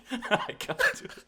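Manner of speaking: breaking into high giggles; cracking up, can't go on; laughing. Real, not acted. Cracking up mid-impression.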